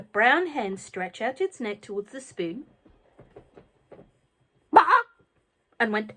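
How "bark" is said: hen squawk. A narrator's reading.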